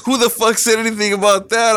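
high-pitched, incredulous mock retort; retold indignation. Girlfriend rejecting his stairwell hopes, retold with high-pitched indignation. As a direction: imitate an indignant girlfriend shooting down the idea.

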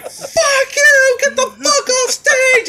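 very high, drawn-out angry shout; acted furious heckler; heckling yell. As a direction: yell the performer off the stage. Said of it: "just yelling at her".

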